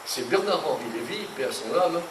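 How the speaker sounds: ordinary talk, nothing marked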